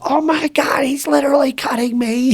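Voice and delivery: very high-pitched imitation of a terrified guest; mock panicked shriek. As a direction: squeal it like a hammed-up victim.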